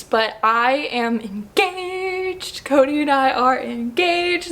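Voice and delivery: drawn-out, overwhelmed big news; emotional announcement. Real, not acted. Woman announces her engagement to viewers, overwhelmed and excited. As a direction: stretch the words out, bursting with the news.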